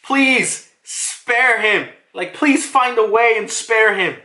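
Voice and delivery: high, drawn-out mock plea; acted pleading. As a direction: beg for a partner's life, retold. Voices Lucci begging for his partner.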